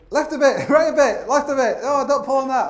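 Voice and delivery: high, loud shouted directions down the wall; calling instructions. Retold or imitated. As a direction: call out directions from above. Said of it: "I was, like, giving all these instructions".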